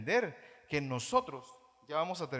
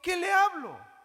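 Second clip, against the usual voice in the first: much higher, louder challenging shout; 12 semitones higher, 8 dB louder than usual. Real, not acted. Pastor challenging his congregation, loud high shout. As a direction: fire the question at the pews.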